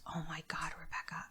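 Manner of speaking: mild whispery disbelief; hushed shock. Retold or imitated. Sister to Rebecca at the missing words, hushed shock. Sister's stunned reaction, retold.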